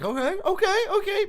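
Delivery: very high, loud build-up; retold anticipation. Retelling their reaction to the song's ending, high and excited. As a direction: squeal it as an imitated excited reaction. Retells expecting the song to dive on.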